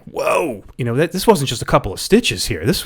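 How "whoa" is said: a high, drawn-out whoa of shock; stunned whoa. Retold or imitated. Reviewer reacts to the surgery scene, high shocked whoa. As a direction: gape at the gore on screen. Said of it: "I'm like".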